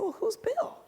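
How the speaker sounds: put-on young lawyer not knowing the name partner; naive surprise. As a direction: play it clueless and high. Her naive reply.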